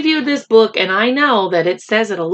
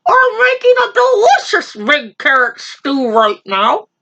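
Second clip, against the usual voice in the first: rabbit impression; 7 semitones higher, 3 dB louder than usual. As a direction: squeak it like a small bold rabbit. Rabbit continues to lion.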